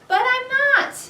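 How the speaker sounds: high, loud insistence; the boy insists he isn't lying; indignant protest. A narrator's reading. Boy protests to his parents, high and loud.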